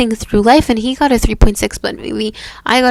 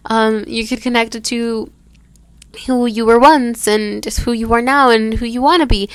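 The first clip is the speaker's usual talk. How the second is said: usual speaking voice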